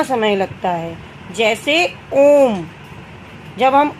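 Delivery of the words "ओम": ओम stretched slowly, the vowel held for a long time; long drawn-out vowel. Shown as a demonstration. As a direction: draw out ओम, long and even. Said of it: "तो हम खींच के बोलते हैं".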